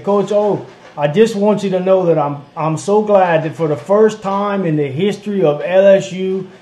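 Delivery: put-on caller with a Cajun bayou accent; lightly voiced Cajun caller. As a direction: imitate a thick bayou caller, laughing at the end. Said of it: "where they talk like this".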